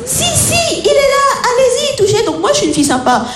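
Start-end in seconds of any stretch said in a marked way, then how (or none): 0.1-2.2 high-pitched imitation of coaxing; mock midwife insisting; insistent midwife. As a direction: urge her on in a put-on high voice. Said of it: "Et elles insistent".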